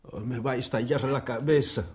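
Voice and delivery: nursing a splitting head; aching head. Acted. Cervantes to his guests, softly groaning with a headache.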